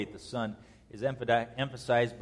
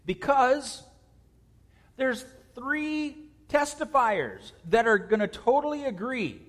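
much higher and louder, a fervent preacher's climb; preaching at full force; 8 semitones higher, 4 dB louder than usual. Real, not acted. Preacher driving home the point to congregation, full-force preaching. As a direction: build to a fervent climax.

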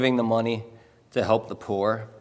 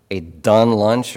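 louder Pennsylvania "are you done lunch?" rising on "done"; 8 dB louder than usual. Retold or imitated. Local repeating the question to the newcomer, louder Pennsylvania phrasing.

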